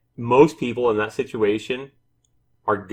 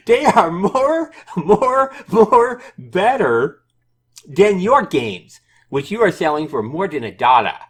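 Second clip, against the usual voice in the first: developer's voice; 9 semitones higher, 6 dB louder than usual. Acted. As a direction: drag out the words in a high put-on voice, amused. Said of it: "try to read this in his, in his voice".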